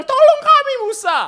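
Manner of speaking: high, loud desperate plea; begging Israelites crying to Moses; pleading crowd. Acted. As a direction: cry out to Moses for help. Begging people voiced in sermon.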